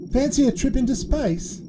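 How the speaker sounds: put-on very high, inviting boy; eager childlike question; boy character Curtis. A narrator's reading. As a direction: offer an adventure as an excited child. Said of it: "Curtis asked Lee and La".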